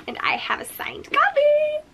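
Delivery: breathy high whisper of glee; giddy hushed confession; excited whisper. Acted. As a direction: whisper it like a thrilling secret. Giddy secret about signed copy.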